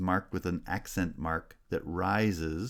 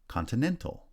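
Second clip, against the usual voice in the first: "Continental", high on "nen"; third-syllable primary stress; 6 semitones higher than usual. Shown as a demonstration. Teacher showing stress shift, third syllable strongest in "Continental". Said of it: "then primary stress on the third syllable".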